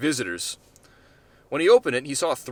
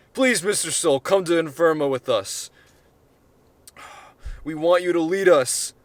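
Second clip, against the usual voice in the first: loud pleading; 5 semitones higher, 6 dB louder than usual. Acted. Ambassador begging on his knees.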